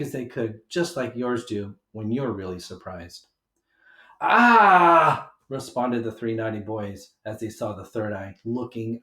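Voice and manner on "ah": high, loud scared cry; frightened cry. A narrator's reading. Naughty boys seeing the third eye, loud scared cry. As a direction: yelp in fright.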